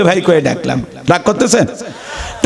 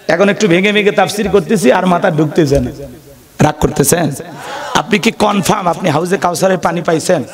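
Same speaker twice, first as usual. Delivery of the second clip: ordinary talk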